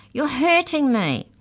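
high, slow, loud wail; shrieking. A narrator's reading. Mallory pleads with Maury swinging the cage, shrieking. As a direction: wail it out in pain. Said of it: "she shrieked".

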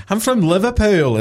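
high, loud hammed-up Scouse; Liverpool accent. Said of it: "is what I heard in my head".

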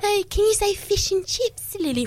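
high, slow mock-British request; "fish and chips" with crisp British ee-like vowels; imitating British relatives. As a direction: coax her in a put-on British accent. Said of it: "over to Britain and to see my relatives".

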